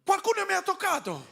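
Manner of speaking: high, laughing cry of alarm; startled exclamation. Pretend. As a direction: exclaim it in sudden surprise. Jesus exclaims he was touched.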